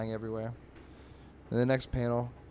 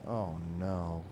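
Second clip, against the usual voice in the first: lower, mildly mournful mock voice; sad cartoon cat; 4 semitones lower than usual. Mooch walking sad in the grass, mock dejected. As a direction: sink into a mock-sad groan of words. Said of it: "he's walking all sad in the grass".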